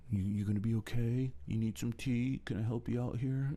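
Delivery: mild mock tender fussing; gentle comforting. Friends comforting the depressed one.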